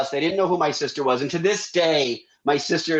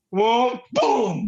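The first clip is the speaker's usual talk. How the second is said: mock crash noise; 6 semitones higher than usual. Truck jolting float.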